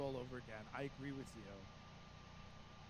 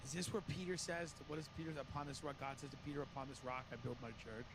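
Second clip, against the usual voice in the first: ordinary talk, nothing marked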